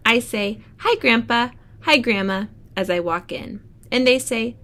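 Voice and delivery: bright storybook read-aloud; reading to children. Acted. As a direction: read it like story time for the class. Teacher reads picture book aloud.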